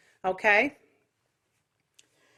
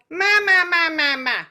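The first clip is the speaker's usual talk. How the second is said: loud, high nasal quack on ma; 6 semitones higher, 7 dB louder than usual. Shown as a demonstration. Voice teacher demos a quacky nasal ma for the class. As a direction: quack it out through the nose. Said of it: "any pitch you want, but kinda quacking".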